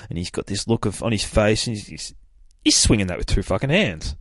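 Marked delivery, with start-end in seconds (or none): none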